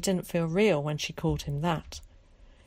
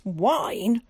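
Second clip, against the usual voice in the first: sharp indignant "Wine?" with a louder burst; angry; 5 semitones higher, 5 dB louder than usual. A narrator's reading. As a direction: snap out "Wine?" in outrage. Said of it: "she said angrily".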